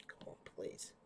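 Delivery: breathy whispered plea; whispering. Real, not acted. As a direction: whisper it under your breath. Muttering to himself about sore wrist.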